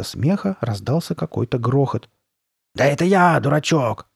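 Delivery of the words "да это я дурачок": loud, raised exasperated call; raised, scoffing cry; shouting. A narrator's reading. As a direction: shout it at a silly little brother. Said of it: "крикнула она".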